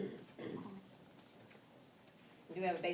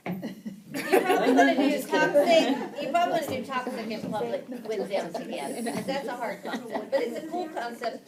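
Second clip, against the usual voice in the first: laughing loudly through the advice; 5 semitones higher, 12 dB louder than usual. Real, not acted. Teacher laughing as she advises tutors, loud and amused. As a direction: chuckle it out, amused at the hard concept.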